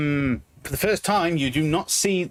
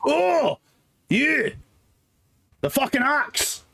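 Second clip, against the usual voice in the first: surprised dwarf; 12 semitones higher, slower than usual. Acted. Dwarf recognizes customer, surprised.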